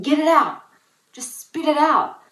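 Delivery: loud, high-pitched impatient demand; exasperated urging. Acted. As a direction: urge them loudly to come out with it. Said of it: "That annoys me so much".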